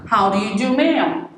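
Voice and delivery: courteous rising how do you do; extra polite greeting. Shown as a demonstration. Greeting sir and ma'am, extra polite. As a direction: address them with deference. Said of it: "You can even go further to say".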